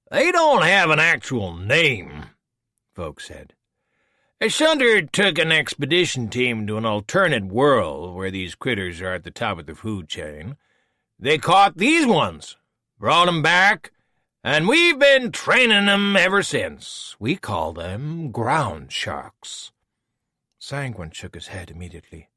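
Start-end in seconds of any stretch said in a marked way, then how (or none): 0.1-2.3 high, slow, sneering villain voice; a smooth, superior schemer taunting an old foe; Voke, smug villain. Acted. 4.4-19.7 put-on a smooth, superior schemer taunting an old foe; Voke, smug villain. Voke explains proudly.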